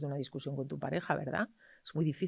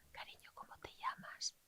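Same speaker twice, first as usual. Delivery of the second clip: put-on soft whispered question to a child; 17 dB quieter than usual. Shown as a demonstration. Doctor whispering to a five-year-old patient. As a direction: whisper gently to calm the child. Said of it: "Hablándole bajito y despacito".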